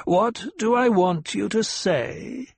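slow, exaggerated cruel mimicry; cruel mimicry, heightened. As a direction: drag out the mockery to sting. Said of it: "The old voice carried a tone of cruel mimicry".

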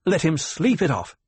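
commanding the guards; ordering. A narrator's reading. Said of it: "she ordered".

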